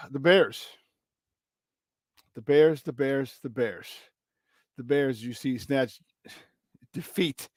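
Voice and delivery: weary lament. Real, not acted. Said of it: "my voice is crazy from last night".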